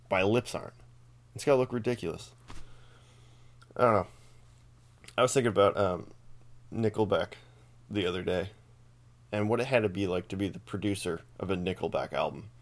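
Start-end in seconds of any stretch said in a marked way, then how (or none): none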